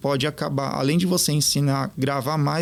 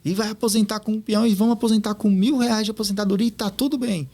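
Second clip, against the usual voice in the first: higher, heated coworker venting; retold angry outburst; 6 semitones higher than usual. Coworker fed up with money talk, mock angry. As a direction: mimic an annoyed coworker telling him to stop. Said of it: "Ele ficou bravo mesmo comigo".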